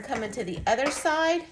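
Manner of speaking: ordinary talk, nothing marked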